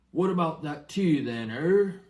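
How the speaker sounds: a hearty giant making himself at home; Hagrid. Acted. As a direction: giant asks the Dursleys for tea, jovial. Hagrid asks for tea.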